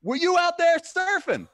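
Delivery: very high, loud put-on voice; mock stoner voice. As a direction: play the beach drinker asking. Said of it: "he's like".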